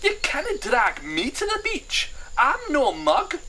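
high, loud, slow refusal; indignant character whine; protesting Scottish grumbler. Acted. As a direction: dig your heels in, Scottish-style. Hamish refuses to go.

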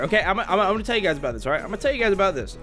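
rapid nonstop chatter. Real, not acted. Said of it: "sorry that I'm yapping so badly".

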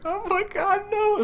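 very high, soft squeal of grief; played-up high-pitched heartbreak; mock tearful wail. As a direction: squeak it out like crying. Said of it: "the third or fourth time that I cried".

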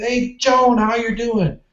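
high, sing-song hello, lightly retold; cheery greeting. Retelling greeting Joan at work, high cheerful hello. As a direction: toss out a chipper hello.

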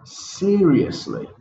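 seriously with SE high and slow; stress on SE. Shown as a demonstration. Teacher modelling seriously, first syllable high and slow. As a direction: draw out and lift SE in seriously. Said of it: "And finally".

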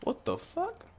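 shrieked surprise. Real, not acted. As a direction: jump out of your skin and squeal it. Sudden scare in horror game.

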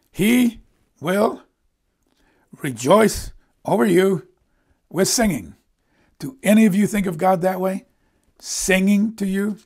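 high, loud, word-by-word proclamation; impassioned preaching. Real, not acted. Preacher telling congregation God will sing over them, triumphant. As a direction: declare each phrase triumphantly, with pauses.

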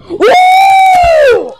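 high, loud playful whoop; cheering whoop. Pretend. Playing, cheering toy.

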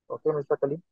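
ordinary talk, nothing marked

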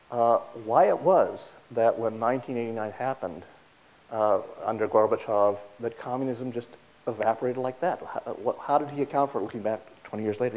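ordinary delivery

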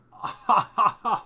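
loud high burst of laughter; excited laugh. Real, not acted. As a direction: laugh out in delight. Laugh at good pull.

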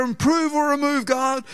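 a hint of a fervent plea; mock pious prayer. Pastor mocking his own prayer about Tim, lightly voiced pleading.

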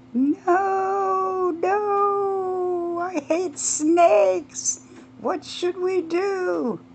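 high, panicky woman's voice; frantic, high-pitched fear; panicked shriek. Acted. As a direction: squeal it out in terror of snakes. Panicked woman sees a snake.

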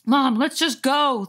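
put-on high, slow, urging boy voice; urging kid. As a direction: tug at mom like a nervous kid. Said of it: "the boy ... urged".

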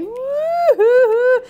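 high, loud fake wail; pretend baby bawling. Doll keeps crying while comforted, loud mock wail. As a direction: imitate a sobbing baby at high pitch.

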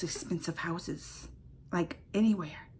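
soft awed whisper. Real, not acted. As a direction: drop to a whisper in awe. Aside about expensive house.

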